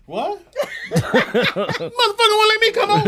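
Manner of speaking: high, loud incredulous laugh-shout; squealing through laughter at the insult; laughing disbelief. Retold or imitated. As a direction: cackle in outraged disbelief. Retelling outrage at being refused.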